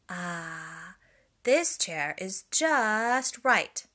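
a sigh, then loud and slow; pleased Goldilocks. A narrator's reading. Goldilocks on the smallest chair, loud slow delight. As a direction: settle in with relief.

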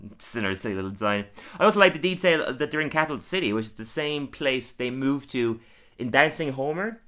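ordinary delivery

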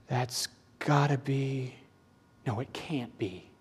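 soft, awed angel, trailing away; hushed disbelief. Acted. Angel unsure what he is seeing, hushed disbelief. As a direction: drop your voice in stunned doubt.

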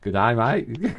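quiet, faint Australian drawl; mock the Aussie greeting softly; a touch of Australian accent. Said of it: "they've got the upward inflection".

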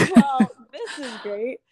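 wry laughing mock cheer; laughing sarcasm. Laughing at her own bad luck, sarcastic and amused. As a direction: laugh it off with a dry little joke. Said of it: "because I'm like".

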